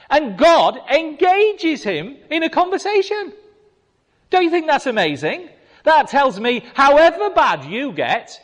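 high, loud preacher's voice; full-force preaching. Real, not acted. Preacher urging his congregation, high and loud. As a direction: preach it out with fervour.

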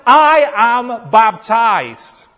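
loud, high declaration at the devil; defiant rebuke. Retold or imitated. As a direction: proclaim it forcefully, drawing out the last words. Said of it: "He would say".

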